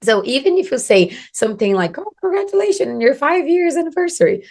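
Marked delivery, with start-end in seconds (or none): 2.0-4.4 cheery mock compliment. Acting out a sample compliment.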